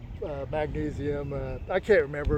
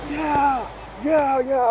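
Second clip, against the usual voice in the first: retold yelling; 7 semitones higher, much slower than usual. Runner to God and the universe, triumphant yelling. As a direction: re-enact yelling at the heavens. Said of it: "I'm, like, yelling to God".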